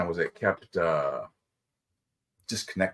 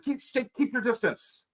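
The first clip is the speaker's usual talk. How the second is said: stern worker voice; 13 semitones higher than usual. Retold or imitated. Retold store worker order.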